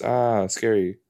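ordinary talk

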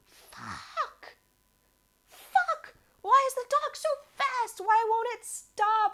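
high-pitched frustrated mock yell; retold yelling. Cyclist yelling after a runaway dog, high mock yell. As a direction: cry out in high, strained exasperation. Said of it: "I kept yelling".

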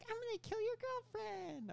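very high, slow cartoon boy voice; a bad squeaky Timmy Turner. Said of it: "I can't do a good Timmy Turner impression".

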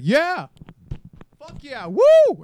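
hammed-up, stretched-out victory whoop; mock cheering moviegoer. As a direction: cheer like a yahoo in the theater. Racist moviegoer cheering at killings.

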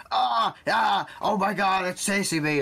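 loud, high panicked yelling; overreacting YouTuber. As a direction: scream like you're being chased. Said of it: "a YouTuber that goes like".

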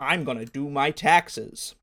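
slow, put-on American accent; Australian trying hard to sound American; best American accent. Shown as a demonstration. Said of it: "with your best American accent".

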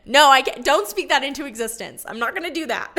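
alarmed denial. Real, not acted. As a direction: shout down the idea, then laugh. Rejecting the pregnancy thought.

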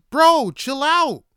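shouting at the coworker to chill out; loud outraged plea. Retold or imitated. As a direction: yell at him to back off. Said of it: "I'm like".

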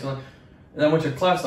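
usual speaking voice

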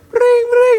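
very high, loud trilled ring; imitated phone ring. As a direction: mimic a shrill telephone.

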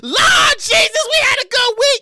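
high, loud, stretched exclamation; hammed-up ecstatic shout; jubilant hollering. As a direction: holler it like a joyful testimony. Celebrates remembered school lunch treat.

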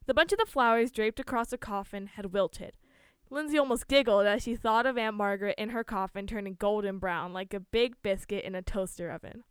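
usual speaking voice

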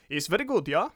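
put-on laughing German accent on very good; chuckling pundit in a German accent; mock German accent through a laugh. Co-host laughing at his own mock German accent. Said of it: "That was my German accent".

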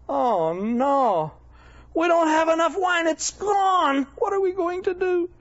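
high-pitched, hammed-up dismay; mock panic. Wedding hosts finding the wine gone, mock panic. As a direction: wail it like a frantic party host. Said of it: "it's really embarrassing, and suddenly".

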